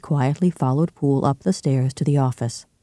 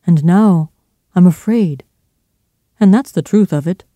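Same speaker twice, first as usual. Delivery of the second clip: louder, higher-pitched admission of fear; frightened confession; 4 semitones higher, 7 dB louder than usual. A narrator's reading. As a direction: confess it with rising agitation. Butler confesses his fear.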